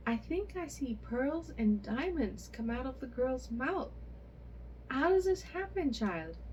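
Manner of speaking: the amazement keeps climbing to the question; astonished. A narrator's reading. A mother asking how pearls come from her child's mouth, amazed cry. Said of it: "quite astonished".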